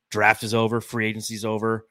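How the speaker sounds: ordinary delivery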